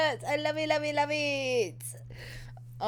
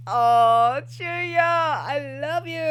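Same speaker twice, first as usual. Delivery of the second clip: fangirl swoon; 2 semitones higher, much slower than usual. Real, not acted. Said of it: "I'm like".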